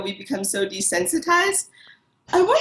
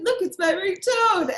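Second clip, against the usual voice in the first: eager show-off; 12 semitones higher than usual. Retold or imitated. Showing off her ringtone.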